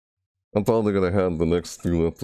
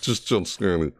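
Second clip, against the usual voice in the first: normal talking voice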